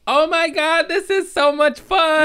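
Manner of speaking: high, loud gleeful kid imitation; thrilled mock-toddler cry; little baby voice. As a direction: squeal with a small child's delight. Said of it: "You can just hear his little baby voice".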